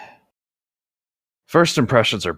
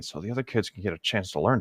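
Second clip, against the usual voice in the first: softer, under the breath; muttered aside; 8 dB quieter, faster than usual. Acted. Teacher mumbling to himself as he walks away, softer. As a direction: mutter it so she can't hear. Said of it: "mumbling under his breath".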